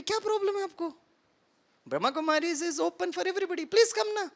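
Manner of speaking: high exasperated plea; plead, pitched high and baffled; mock baffled host pleading, voice high; exasperated coaxing. Coaxing the man inside.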